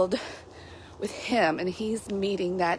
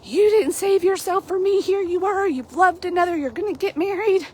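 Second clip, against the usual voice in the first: mock-wounded rant, pitched way up; jealous angry Westley; 10 semitones higher than usual. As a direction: hurl the accusations like a betrayed lover. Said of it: "he gets really jealous and angry".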